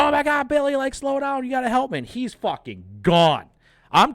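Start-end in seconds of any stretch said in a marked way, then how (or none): 0.0-2.0 high, pleading imitated girlfriend; girlfriend's panicked plea. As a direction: squeal it like the helpless girlfriend. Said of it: "She's like".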